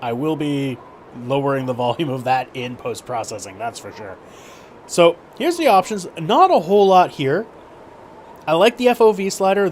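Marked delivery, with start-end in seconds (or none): 4.9-9.7 shouting over wind. Real, not acted. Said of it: "I'm shouting over the wind".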